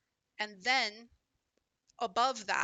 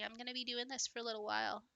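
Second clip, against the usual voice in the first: usual speaking voice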